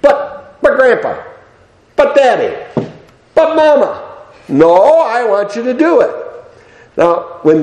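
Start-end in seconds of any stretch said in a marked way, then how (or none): 0.0-4.0 put-on high, whining child's protest; whiny child. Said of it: "the little three-letter word". 4.5-6.1 firm grandpa. Retold or imitated. Grandpa insisting to child.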